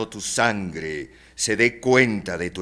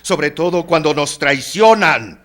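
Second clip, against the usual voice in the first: high, loud, vehement outburst; impassioned lament; 8 semitones higher, 8 dB louder than usual. Acted. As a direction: cry out against the betrayal. Answers in kind, bitter about betrayal. (lamento apasionado, fuerte y agudo)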